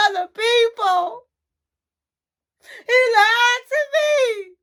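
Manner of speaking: high, loud sobbing wail; crying out. Retold or imitated. Faith telling friends her boyfriend lied, sobbing high and loud. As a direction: wail it out through tears.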